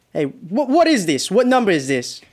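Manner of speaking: high, loud, horrified outburst; frantic yell into the phone; panicked shout. Acted. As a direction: scream it in disbelief and terror. Son learns of his own death.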